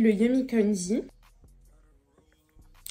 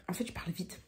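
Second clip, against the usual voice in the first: suddenly much softer, reining herself in; calming down; 3 semitones lower, 11 dB quieter, much faster than usual. Real, not acted. Vlogger catching herself talking too fast, then softer. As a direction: pull it back to a hush. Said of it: "je parle vite. Faut que je me calme".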